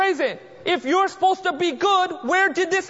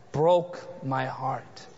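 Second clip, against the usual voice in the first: quiet heartbreak; 13 semitones lower, 4 dB quieter, much slower than usual. Real, not acted. Telling of a funeral.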